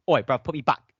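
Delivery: loud put-on London roadman voice; rough London slang. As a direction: shout it like a cheeky London roadman. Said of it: "it's just like".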